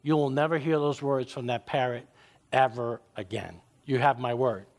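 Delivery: low, reassuring owner voice; calming shop owner. Acted. Pet-store owner calming an angry lady, low and reassuring. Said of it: "The owner calms her down and says".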